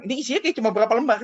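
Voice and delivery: high, loud, fast incredulous complaint; incredulous rant. Retold or imitated. New hire complaining about his pay, fast high incredulity. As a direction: rattle it off in disbelief.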